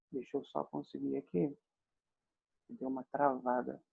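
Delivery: quiet aside. Real, not acted. Teacher to self as the screen freezes, quiet aside. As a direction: murmur while fiddling with a stuck tool. Muttering over a technical hitch.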